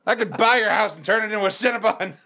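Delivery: high-pitched boast through laughter; hammed-up neighbor taunt. Dickhead neighbor threatening a house purchase, high gleeful taunt. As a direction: crow it as the obnoxious rich neighbor.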